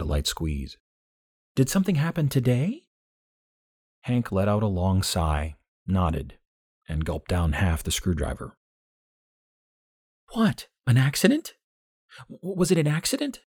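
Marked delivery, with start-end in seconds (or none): 1.5-2.8 much higher, worried woman's voice; concerned June. A narrator's reading. 10.3-13.4 much higher, startled rising questions; shocked June. A narrator's reading. June voiced by narrator.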